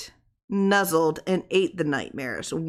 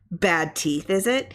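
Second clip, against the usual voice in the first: ordinary delivery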